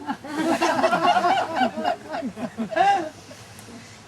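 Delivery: big laugh at his own joke; loud laugh. Real, not acted. Comic to audience at own punchline, loud laugh. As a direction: laugh out loud, cracking yourself up.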